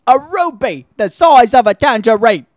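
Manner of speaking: high, loud put-on old English voice; Michael Caine impression. Host repeating Michael Caine's line in put-on voice. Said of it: "Future fucking".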